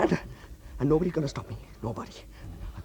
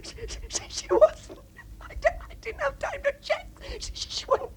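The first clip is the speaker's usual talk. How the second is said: distraught. Acted. Breakdown after tragedy.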